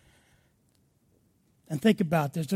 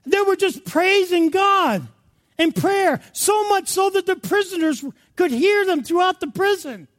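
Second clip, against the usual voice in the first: high, impassioned pulpit shout; raised, ringing sermon voice; full-force preaching; 8 semitones higher than usual. Real, not acted. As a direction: preach it out to the whole room.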